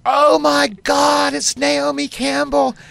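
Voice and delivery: high, loud, drawn-out squeal of recognition; star-struck shriek. Retold or imitated. Passers-by spot a supermodel in Times Square, shrieking. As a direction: imitate a crowd screaming at a celebrity.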